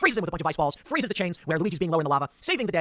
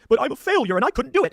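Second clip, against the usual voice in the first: higher and louder, a hammed-up self-pitying whine; mock whiny Mario; 8 semitones higher, 7 dB louder than usual. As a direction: play Mario moping like a sad hero. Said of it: "And Mario's like".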